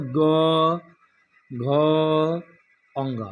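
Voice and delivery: ग, घ, अंग spoken low with a humming buzz in the throat; ग, घ, अंग with throaty vibration, ghosh; buzzing voiced consonants. Shown as a demonstration. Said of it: "घसघसाता है".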